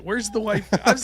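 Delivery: ordinary delivery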